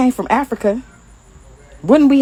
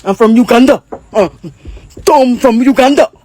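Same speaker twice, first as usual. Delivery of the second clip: hammed-up fake Ugandan accent; loud pretend African accent; mock African accent; 5 dB louder than usual. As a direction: do an exaggerated Ugandan accent for a joke. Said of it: "with some type of accent if we all from Africa".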